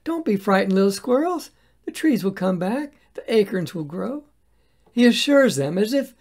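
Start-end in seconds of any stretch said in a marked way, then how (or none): 0.0-4.2 higher, gentle voice soothing small animals; tender reassurance. Acted. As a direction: coax the little squirrels not to fear. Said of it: "He turns to the squirrel and says".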